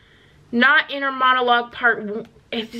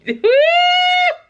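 very high, loud laughter; high shrieking laugh; 6 dB louder than usual. Real, not acted. Laughing at lyric.

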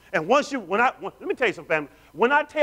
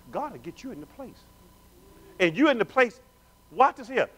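ordinary delivery

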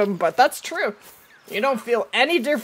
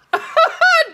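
bright burst of laughter; high laugh; 6 dB louder than usual. Real, not acted. Laughing at own answer.

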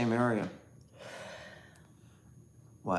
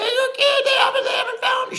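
high-pitched frantic scream, louder; imitated screaming; 6 dB louder than usual. A friend's mom at the door at five a.m., imitated screaming. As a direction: scream it out like a panicked mother. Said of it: "she was screaming to my mom".

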